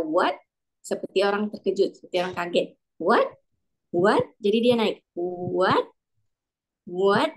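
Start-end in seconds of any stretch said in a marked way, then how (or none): 3.0-4.2 What? climbing upward; teacher shows tone two; rising what. Shown as a demonstration. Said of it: "naik dan meninggi". 5.1-7.3 What? rising to the top. Shown as a demonstration. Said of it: "Jadi dia naik ke atas".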